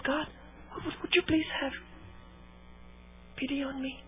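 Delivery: a gentle, softened plea to God; soft pleading. Retold or imitated. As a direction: plead softly as Hannah would. Voicing Hannah's quiet plea.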